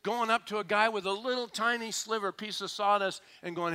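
ordinary talk, nothing marked

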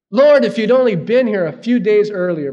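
loud, high, bitter cry of reproach; a grieving sister's loud, frustrated reproach; bitter frustration. Retold or imitated. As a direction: throw the words at him in frustration. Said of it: "I can detect bitterness there. Frustration".

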